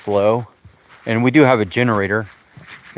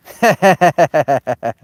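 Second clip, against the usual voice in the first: a short burst of real laughter; amused laugh; 5 semitones higher, 7 dB louder than usual. Real, not acted. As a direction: laugh off the prank caller's joke. Laughing at birthday prank.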